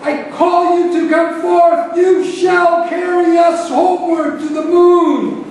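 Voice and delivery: very loud, high incantation; full-force epic invocation, pitched high; fervent bellowing. A narrator's reading. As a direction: bellow a summons to the spirits. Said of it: "he bellowed to the air".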